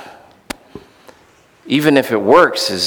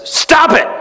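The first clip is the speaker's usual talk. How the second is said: higher, louder blunt shout of stop it; sudden shout; 3 dB louder than usual. Retold or imitated. Therapist suddenly shouting at his eager patient. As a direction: bark the order out of nowhere. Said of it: "Bob Newhart says".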